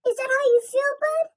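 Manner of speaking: high-pitched mock doting mother; mocking Peter's mom. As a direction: imitate a mother fussing over her son. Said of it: "Peter's mom call him Bud".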